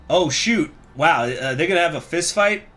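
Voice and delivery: ordinary delivery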